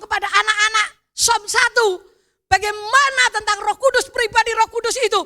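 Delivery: preaching at full force. Acted. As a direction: proclaim it to the congregation.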